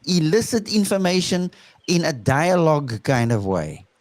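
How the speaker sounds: ordinary talk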